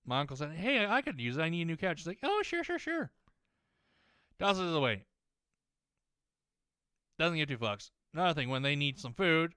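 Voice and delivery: ordinary talk, nothing marked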